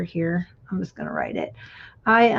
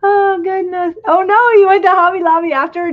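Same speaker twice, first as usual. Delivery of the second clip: high, loud burst of delight; excited surprise; 9 semitones higher, 8 dB louder than usual. Real, not acted. Delighted shout on hearing a friend went to Hobby Lobby. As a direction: exclaim with real thrill at the news.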